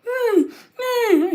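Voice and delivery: high, whimpering mock whine; dog whining. Host mimics his dog begging for food, high whimpering whine.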